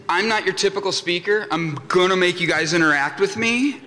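usual speaking voice

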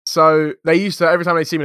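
normal talking voice

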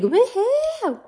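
high, loud mock cheer; celebratory whoop. Viewer reacting to surprise arrival, high excited whoop.

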